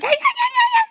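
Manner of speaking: very high, laughing Scouse squeal; squeaky Scouse. Retold or imitated. Friend joking about her own Scouse voice, squeaky. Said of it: "'cause I'm Scouse".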